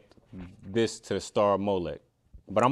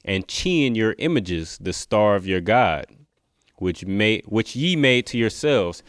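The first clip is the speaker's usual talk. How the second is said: loud, declaiming verse reading; scripture reading; 6 dB louder than usual. Acted. Preacher reading the verse to listeners, scripture reading. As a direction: read the verse out with force. Reads scripture aloud to viewers.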